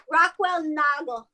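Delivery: ordinary talk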